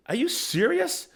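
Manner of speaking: high, slow disbelief; incredulous. Retold or imitated. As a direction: drag it out, stunned and high. Coach disbelieving the rival's brawling.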